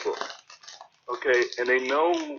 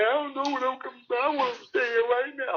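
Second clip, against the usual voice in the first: high, pleading, tearful mock whine; imitating crying friend; 12 semitones higher than usual. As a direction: act out a heartbroken man begging to stay. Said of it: "This dude is literally breaking down".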